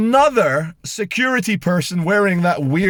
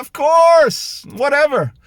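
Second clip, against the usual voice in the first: high, louder breezy imitation; mock casual reply; 9 semitones higher, 4 dB louder, slower than usual. Parent in lift answers politely asking father, breezy mock voice. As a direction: toss it off carelessly. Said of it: "And, like".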